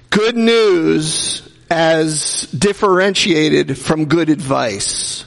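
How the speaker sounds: "Good news" and "good advice" drawn out slowly, each word weighted; slow emphatic contrast. Real, not acted. Pastor stressing the key distinction to his congregation, slow and deliberate. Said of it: "I want to make it very clear".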